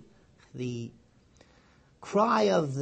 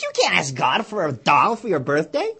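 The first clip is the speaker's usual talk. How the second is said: mocking brother; 9 dB louder than usual. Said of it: "bursts into laughter. Says".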